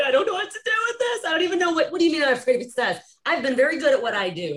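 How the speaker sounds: high quick flustered protest. Retold or imitated. Student protesting to the teacher, high and flustered. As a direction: rush the words, thrown off. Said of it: "I was like".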